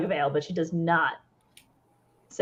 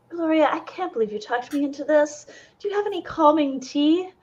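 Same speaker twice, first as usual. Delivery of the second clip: anxious; 7 semitones higher than usual. Acted. Ella asks for calming tea.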